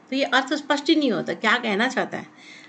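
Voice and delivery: normal talking voice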